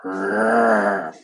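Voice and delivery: low, rumbling animal growl; wolf growl. Acted. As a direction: growl in answer, low in the throat. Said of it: "And the grandma said".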